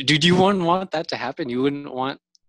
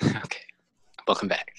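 whisper; 5 dB quieter than usual. Pretend. Playing along whispering.

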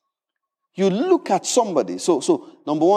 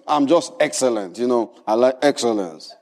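lower-pitched, put-on self-satisfied voice; judgmental excellence-lover; 8 semitones lower than usual. Preacher mimicking judgmental people who call it excellence, in a low different voice. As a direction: say it low, as if excusing yourself. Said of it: "You say it in a different voice".

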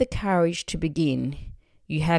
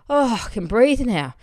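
big loud sigh of relief; acted relief; 6 semitones higher, 5 dB louder than usual. Author who has just released the book, exhaling in relief. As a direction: let it out high and loud, relieved. Acting out relief after publishing.

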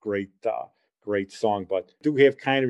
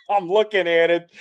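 laughing; 5 semitones higher, 4 dB louder than usual. Real, not acted. Laughing while recalling the magazine.

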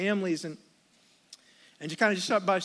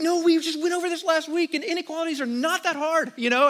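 higher, pushed scolding voice; mock exasperated scolding; 9 semitones higher, 3 dB louder than usual. Dad scolding his son over wrong homework, higher and exasperated. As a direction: lecture him like a fed-up dad. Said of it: "so I'm like".

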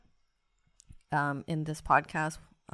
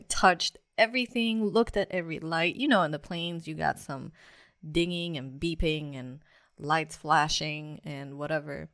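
normal talking voice

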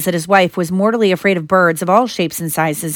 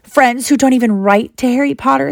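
higher-pitched, lightly sly elf; 6 semitones higher than usual. A narrator's reading. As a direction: needle them with a touch of slyness. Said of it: "said Dobby slyly".

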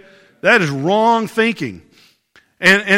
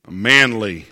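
"manly" dropped low and deep; 9 semitones lower than usual. Shown as a demonstration. Pastor hamming up the meaning of Andrew's name, low "manly". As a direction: say "manly" like a tough guy. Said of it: "You just gotta say that a little".

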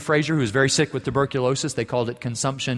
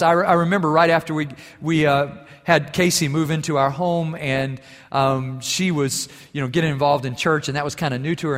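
usual speaking voice

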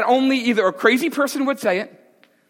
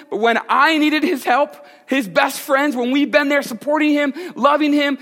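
angry complaint; 6 semitones higher than usual. Retold or imitated. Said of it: "she's angry".

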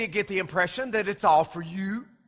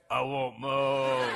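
put-on low, slow little-girl demand; deep-voiced toddler; 8 semitones lower, 5 dB quieter, much slower than usual. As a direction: drag it out deep like a toddler wanting milk. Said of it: "turns around and says".

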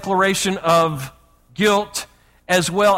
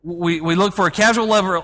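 usual speaking voice

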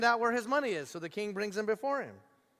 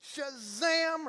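higher, bright proclamation of freedom; joyful release; 8 semitones higher, much slower than usual. Retold or imitated. King joyfully setting the debtor free. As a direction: announce freedom like magic. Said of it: "the king goes".

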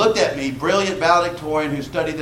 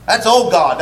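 ordinary talk

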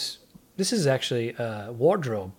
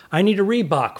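louder, called out to a big crowd; calling out; 6 semitones higher, 5 dB louder than usual. Retold or imitated. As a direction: project it to the back rows.

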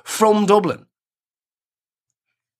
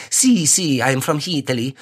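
hammed-up stage Italian waiter accent, lower; cartoonish Italian accent; 4 semitones lower than usual. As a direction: lay on a fake Italian accent, proud of it. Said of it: "cartoonish Italian accents".

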